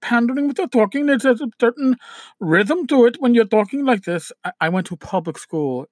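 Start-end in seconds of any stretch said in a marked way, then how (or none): 0.0-4.3 higher-pitched put-on Indian accent. Shown as a demonstration. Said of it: "where the people talk like this".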